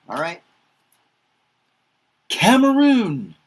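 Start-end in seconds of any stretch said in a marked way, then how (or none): 2.3-3.4 high, slow "Cameroon", drawn out; teacher modelling "Cameroon" up high; careful pronunciation. Shown as a demonstration. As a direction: sing out "Cameroon" clearly for learners. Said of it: "teaching country names by repetition".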